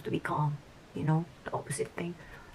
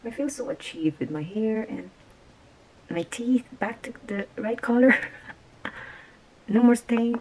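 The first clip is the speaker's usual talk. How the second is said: delighted; 5 semitones higher, 7 dB louder than usual. Real, not acted. Delighted with teeth and hair.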